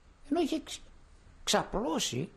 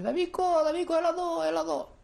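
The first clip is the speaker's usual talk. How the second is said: much higher, louder call from afar; father calling his son across the yard, high and loud; 6 dB louder than usual. Retold or imitated. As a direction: call the boy over from a distance. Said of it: "με φωνάζει".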